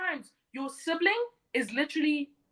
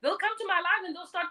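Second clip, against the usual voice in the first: usual speaking voice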